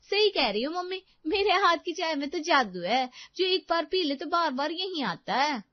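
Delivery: high, loud sarcastic boasting; high-pitched daughter-in-law on a call; loud phone talk. A narrator's reading. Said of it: "जोर-जोर से बातें करने लगती है".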